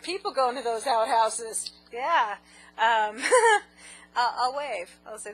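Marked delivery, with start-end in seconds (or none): 3.3-4.1 loud high laugh; high squealing laugh. Real, not acted. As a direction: burst out laughing. Laughing fit.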